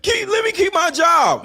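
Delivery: hammed-up pleading whine; mocking whine. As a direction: mimic a begging employee with scorn. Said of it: "you wanna sit here and".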